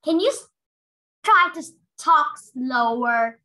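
slow pleading request; asking to slow down. Acted. Comic girl asking the fast boy to slow down. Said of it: "this girl said".

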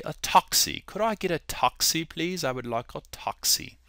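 soft, amateur British accent; quiet imitation of British speech. Shown as a demonstration. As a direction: ask politely in a put-on British accent. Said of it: "give you my British accent".

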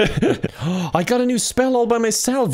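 high, giggling delight; laughing with glee. Real, not acted. Gleeful after learning a new spell alone, laughing. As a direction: laugh through it, thrilled.

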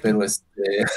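chuckling through the words; amused laughter breaking in; laughing. Real, not acted. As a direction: laugh as you start the sentence. Laughing at joke.

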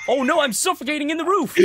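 panicked. Real, not acted. Stuck in the roof.

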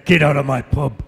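lightly put-on tough landlord order; tough character voice. Actor trying a soap landlady's line in game-hero voice, forceful. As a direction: try the catchphrase with some force. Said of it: "I have to put the".

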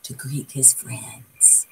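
whisper. Shown as a demonstration. Narrator hushing the moment Armadillo steps out, whispered. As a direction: breathe it out in a hush. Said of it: "whispered narration".

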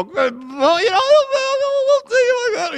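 loud, high-pitched comic blubbering; mock sobbing. Grandpa imitating the whole family crying at goodbye, mock sobbing. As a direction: wail through the words as if sobbing. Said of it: "it's like sobbing".

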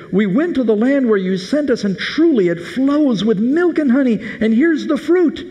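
high, eager, proud announcement; excited report. Retold or imitated. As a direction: bring the good news with delight. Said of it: "And they told them and said".

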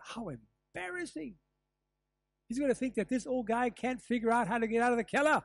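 high-pitched, mortified inner voice; embarrassed self-talk. Acted. Old guy stuck in the cellar thinking to himself, embarrassed. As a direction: act out squirming embarrassment. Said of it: "perhaps I would have thought".

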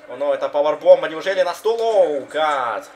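loud rising wrestling hype call; excited commentary. Real, not acted. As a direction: yell out the big powerbomb. Powerbomb excitement. (возбуждённый комментарий)

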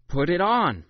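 won't take no for an answer; insisting. A narrator's reading. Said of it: "my mother insisted".

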